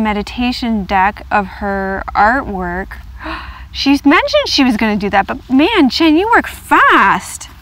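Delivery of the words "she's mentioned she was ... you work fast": higher, louder, slowing on "you work fast"; thrilled gushing. Real, not acted. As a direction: gush with delighted awe. Gushing over friend's art.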